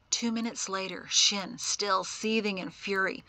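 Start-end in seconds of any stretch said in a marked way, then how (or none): none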